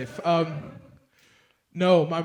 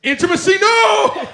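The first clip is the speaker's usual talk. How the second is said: laughing shout; 12 dB louder than usual. Real, not acted. Crowd banter, laughing shout.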